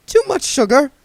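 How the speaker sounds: high, fussy genteel voice; prissy old vagrant, fussing; duck man voice. A narrator's reading.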